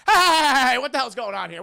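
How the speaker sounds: loud, high mock protest; Jonathan Harker. Jealous Harker interrupts Dracula and Mina, mock yell. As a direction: holler in exaggerated objection. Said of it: "Jonathan Harker's like".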